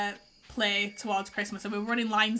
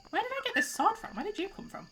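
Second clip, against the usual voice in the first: much higher, baffled question; puzzled surprise; 9 semitones higher, 5 dB quieter than usual. Real, not acted. Gamer finding a mystery sword, high puzzled surprise.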